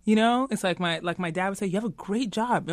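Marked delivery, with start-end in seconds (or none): none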